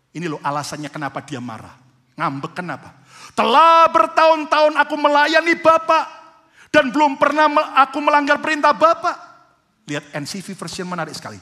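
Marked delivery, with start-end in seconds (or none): 3.4-9.1 loud, high, driving sermon delivery; full-force preaching. Acted. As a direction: preach it with rising fire to the congregation. Quoting the elder son, preached.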